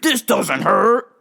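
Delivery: slow, strained put-on voice; mock denial of pain. Opponent in a toe hold insisting to the grappler, slow mock strained denial. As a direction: drag it out like someone pretending not to hurt. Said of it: "He's just looking at me like".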